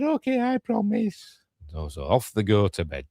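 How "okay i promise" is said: very high put-on child voice; child promising. Child answering mother's warning, high mock child promise. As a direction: promise in a squeaky little kid voice. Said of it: "so he said".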